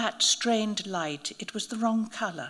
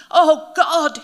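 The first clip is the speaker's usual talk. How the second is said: much louder, higher cry of pain; 8 semitones higher, 14 dB louder than usual. A narrator's reading. Bitten priest crying out in pain, loud. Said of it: "he moaned".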